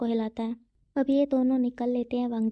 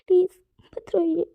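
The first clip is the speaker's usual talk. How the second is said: "Please मत रोइए" louder, voice cracking into crying; "please मत रोइए" louder, tears taking over; breaking into tears; 6 semitones higher, 4 dB louder than usual. Acted. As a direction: let the plea break into a sob. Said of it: "वांगजी भी रोना शुरू कर देता है".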